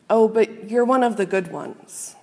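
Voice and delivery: usual speaking voice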